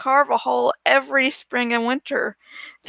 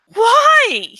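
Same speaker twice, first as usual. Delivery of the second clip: high, loud alarmed why; sudden higher, louder outburst; startled question; 8 semitones higher, 6 dB louder than usual. A narrator's reading. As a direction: jump up in shock. Aragorn startled by refusal.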